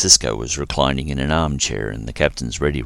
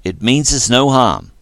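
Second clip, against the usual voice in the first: firm reassurance; 9 semitones higher, 8 dB louder than usual. A narrator's reading. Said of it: "Dax ordered".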